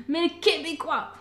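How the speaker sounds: ordinary delivery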